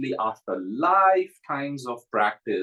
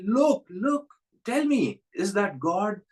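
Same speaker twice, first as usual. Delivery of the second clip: insistent challenge; 7 semitones higher, slower than usual. Retold or imitated. Master presses the yogi.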